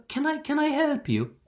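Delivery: put-on high, sweet old lady voice; old Irish lady. Old lady offering help to tourists, high and sweet. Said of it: "this old lady comes by".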